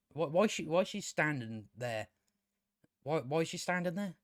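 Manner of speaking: soft, puzzled quote; understated bafflement, voice dropped; puzzled aside. Retold or imitated. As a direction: wonder it quietly, as a baffled onlooker. Acting what onlookers should say.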